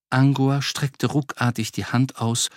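ordinary delivery

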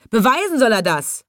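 high insistent demand; mild yell. A narrator's reading. Disbelieving men demanding proof, yelling mildly. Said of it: "brüllten sie".

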